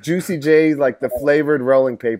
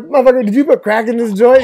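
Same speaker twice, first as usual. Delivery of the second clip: mock accusing shout; 9 semitones higher, 5 dB louder than usual. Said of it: "I'm like".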